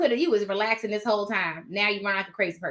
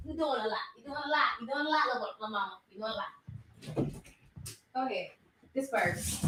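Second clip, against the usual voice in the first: soft scolding; 10 dB quieter than usual. Real, not acted. Mother gently scolding the puppy chewing her shoe. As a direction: chide the dog under her breath.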